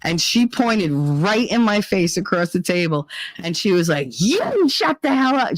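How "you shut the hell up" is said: sharp mock yell, pitched up; retold yell. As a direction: snap it at her like a scold. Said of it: "She yelled at me".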